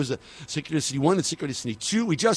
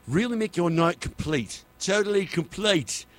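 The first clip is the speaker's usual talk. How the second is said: put-on slipping into a light British accent, pitched higher; a playful DJ's mock British accent; 5 semitones higher than usual. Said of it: "why I went into my British accent".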